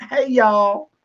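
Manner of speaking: Southern accent. Shown as a demonstration. Host demonstrating the greeting for Australian guests, Southern accent. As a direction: give it a stretched Southern twang. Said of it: "say it with a Southern accent".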